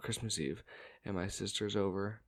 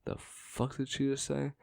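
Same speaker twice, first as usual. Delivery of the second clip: shocked disbelief; 6 semitones higher than usual. Retold or imitated. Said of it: "staring at each other like".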